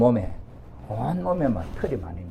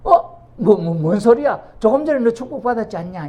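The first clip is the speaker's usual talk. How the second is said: put-on startled old father; 9 semitones higher, 5 dB louder than usual. Startled father asking the son who just arrived why he comes again. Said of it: "깜짝 놀란 이삭이".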